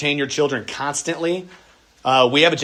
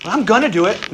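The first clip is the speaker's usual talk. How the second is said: put-on stalling child; 8 semitones higher, 6 dB louder than usual.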